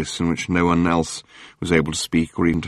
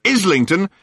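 gushing tabloid hack; 11 semitones higher than usual. Acted. Journalist Murray greeting Arthur on the phone, gushing. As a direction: lay the flattery on thick.

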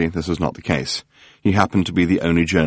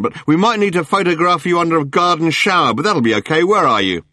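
gushing tabloid hack; 9 semitones higher than usual. Acted. Journalist Murray greeting Arthur on the phone, gushing. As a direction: lay the flattery on thick.

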